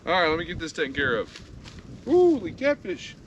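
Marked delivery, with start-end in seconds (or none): 2.0-3.2 higher, louder, stretched "Holy catfish"; excited exclamation. Real, not acted. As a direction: belt out "Holy catfish" in delight. Exclaiming at a caught fish.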